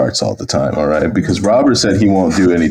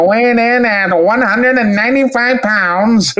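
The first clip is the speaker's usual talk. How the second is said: put-on old-timey announcer; 14 semitones higher than usual. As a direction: announce the batter like the 1920s. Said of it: "really good old-timey announcer voice".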